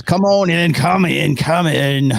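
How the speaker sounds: big character voice, starting high and settling; deep-voiced figure. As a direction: call them in, in character. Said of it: "very deep voice".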